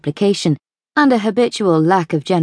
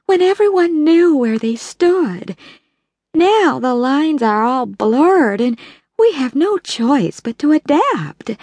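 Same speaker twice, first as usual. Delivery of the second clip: put-on slow, much higher, gentle older lady; Belle's voice; 6 semitones higher, slower than usual. A narrator's reading. Belle to the bridge ladies, in a gentle, wistful lament.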